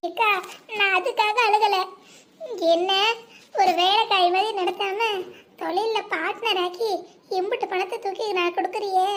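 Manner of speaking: ordinary talk, nothing marked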